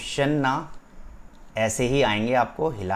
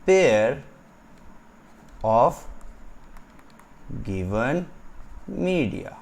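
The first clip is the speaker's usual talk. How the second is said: stretched words in short phrases, long pauses; slow dictation; much slower than usual. Shown as a demonstration. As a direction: spell out the definition for note-takers. Dictates a definition to write. (खींच-खींच कर लिखवाते हुए)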